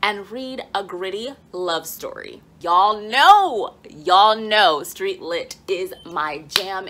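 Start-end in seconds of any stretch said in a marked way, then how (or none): 2.6-6.9 loud, slow gush over her favorite genre; excited. Real, not acted. Booktuber to viewers, loudly gushing about street lit. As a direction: belt it out like a proud fan.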